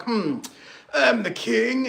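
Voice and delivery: preening mock king; pompous king. King brushing off his smart advisors, pompous mock voice. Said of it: "And you're like".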